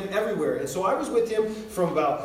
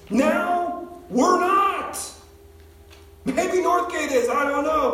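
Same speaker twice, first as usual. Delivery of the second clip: high, urgent exhortation; impassioned preaching; 7 semitones higher, 3 dB louder than usual. Real, not acted. Preacher urging older believers to disciple others, impassioned preaching. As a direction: drive each point home to the congregation. Exhorts the congregation at full force.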